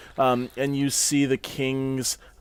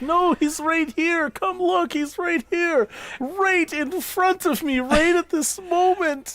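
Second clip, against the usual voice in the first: mock grieving. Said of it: "trying to be like".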